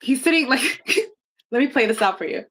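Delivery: ordinary talk, nothing marked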